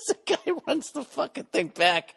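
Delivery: imitating a friend, words squeezed out through a high laugh. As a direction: pitch way up, laughing as you say it. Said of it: "Bartnick has this high-pitched laugh".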